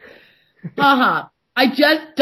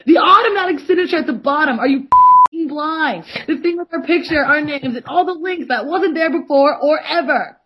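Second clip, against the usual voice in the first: higher and louder, ranting; all caps rant; 5 semitones higher, 4 dB louder than usual. Retold or imitated. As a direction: yell it like an angry all-caps message. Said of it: "And then in all caps".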